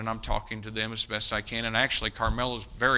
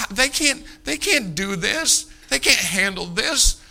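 very high, loud and slow, near crying out; stretched, pitched-up despairing cry; distraught; 10 semitones higher, 9 dB louder, slower than usual. Retold or imitated. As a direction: plead for the family in anguish. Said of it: "he's just distraught".

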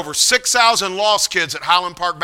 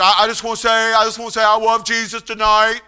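higher, childlike imitation with w for l and r; pitched-up, level, heartfelt declarations; Paul preaching; 4 semitones higher, 3 dB louder than usual. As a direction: imitate an earnest, simple young man testifying. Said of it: "He gets up, and he said".

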